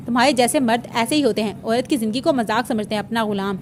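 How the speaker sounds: plain reading voice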